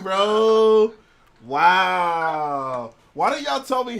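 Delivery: loud, high shout of amazement; excited shout. Real, not acted. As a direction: yell it in disbelief. Shocked at Harry's kisses.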